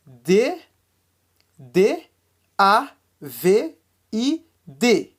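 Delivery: slow, letter by letter; spelling out. Shown as a demonstration. Teacher spelling David for learners, letter by letter. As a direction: spell with a pause after each letter. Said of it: "tem que falar cada letra separada".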